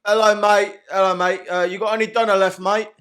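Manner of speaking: loud London geezer ordering a kebab. Pretend. Customer hailing the kebab man, loud geezer voice. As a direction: play a cheery geezer customer at the counter. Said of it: "I'll be like a geezer".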